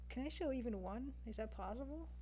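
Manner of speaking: ordinary talk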